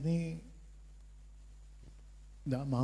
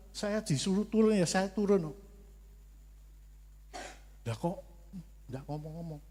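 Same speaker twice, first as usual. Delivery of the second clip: usual speaking voice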